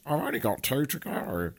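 hammed-up funny voice, unlike your own; odd put-on voice. Shown as a demonstration. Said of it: "I said it in that voice".

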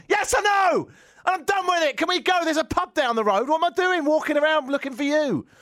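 high, loud fed-up yell; shouting at his mate to leave; exasperated shout. Retold or imitated. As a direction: snap at your mate, done with it all. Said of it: "I was really angry".

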